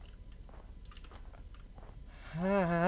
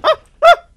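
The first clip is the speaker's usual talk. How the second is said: two very high, loud yelps; startled yelps; 14 dB louder than usual. Real, not acted. Player hit by a scare, short shrieks. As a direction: yelp twice in shock. Jump scare in horror game.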